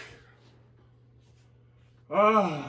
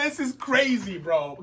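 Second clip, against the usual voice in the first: usual speaking voice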